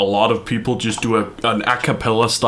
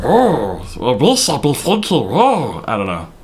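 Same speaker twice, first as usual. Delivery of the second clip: Gollum voice; 2 semitones higher than usual. Pretend. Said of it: "do Gollum".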